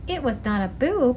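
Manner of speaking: ordinary delivery, nothing marked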